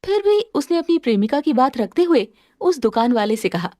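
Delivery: ordinary delivery, nothing marked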